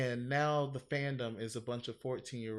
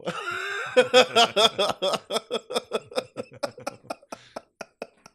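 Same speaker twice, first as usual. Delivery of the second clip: big burst of real laughter; cracking up loud at her own retelling; 9 semitones higher, 10 dB louder than usual. Real, not acted. Laughing hard while telling a friend the drag story.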